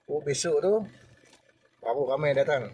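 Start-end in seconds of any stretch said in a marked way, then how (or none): none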